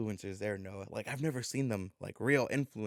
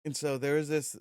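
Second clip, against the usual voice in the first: usual speaking voice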